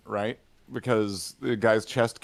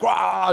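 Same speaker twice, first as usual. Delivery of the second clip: mock roar; 7 semitones higher, 4 dB louder than usual. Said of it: "And he's like".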